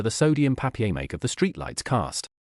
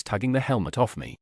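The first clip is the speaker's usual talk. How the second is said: ordinary delivery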